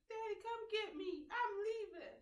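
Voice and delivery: put-on high child voice calling daddy; little boy. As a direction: sound like a kid on the phone. Son voiced in story.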